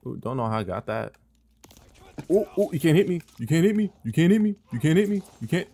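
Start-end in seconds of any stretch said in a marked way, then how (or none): 2.3-5.7 taunting. Real, not acted. Taunting enemy in game.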